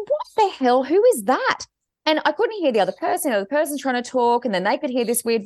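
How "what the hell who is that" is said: high-pitched, bewildered retelling; acted-out indignant bafflement; baffled outburst. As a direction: pitch it up in mock disbelief. Baffled by voice on phone.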